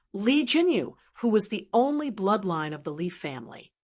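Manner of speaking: ordinary delivery, nothing marked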